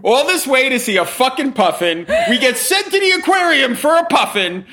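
high, incredulous rant rising through laughter; hysterical mock outrage. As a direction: replay your outburst, pitch climbing each phrase. Retells hysterical outburst at sign.

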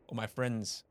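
usual speaking voice

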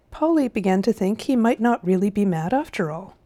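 usual speaking voice

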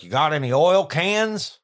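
loud, slow, high picker's question; Frank. Retold or imitated. Host playing Frank from American Pickers, loud eager impression. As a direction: call it out as a drawn-out folksy question. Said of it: "Frank is like".

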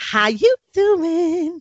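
high, slow, sing-song hello; high chipper greeting. Shown as a demonstration. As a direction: lift it way up, slow and sweet. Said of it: "That's how you do it".

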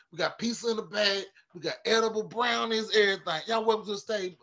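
high hyped emcee voice; mock host intro. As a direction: announce him like a stoned host.

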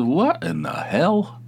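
slow, high disbelief; a slow, stunned what in the hell; incredulous. Retold or imitated. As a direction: drag it out in amazement. Shocked at friend's salad order.

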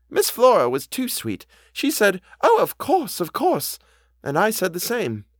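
put-on high-pitched housekeeper quoting her young master; earnest housekeeper. A narrator's reading. Housekeeper repeats Miles's words to the governess, high and earnest.

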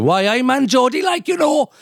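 high-pitched mock Geordie; Geordie accent. Doctor shows off his accents, exaggerated Geordie. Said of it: "So I can do them all".

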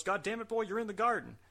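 high-pitched retold referee; Earl Hebner. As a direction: exclaim it as the referee, pitched well up. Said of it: "He goes".

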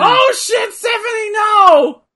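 high, loud panicked cry; alarmed shout. Real, not acted. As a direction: yell out in alarm. Reacting to the wrestling on screen.

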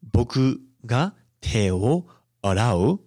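"Boku ga te wo arau" said slowly and clearly; slow model Japanese. Shown as a demonstration. Teacher models the Japanese sentence slowly for students. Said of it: "so I could say".

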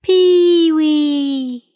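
long, high cry carrying far; kiwi cry. A narrator's reading. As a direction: send "Pee-wee" out over the hills. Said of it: "his cry went out".

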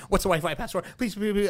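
mock-nervous repeated pleas. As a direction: reenact begging in a tizzy. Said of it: "I'm, like, so nervous".

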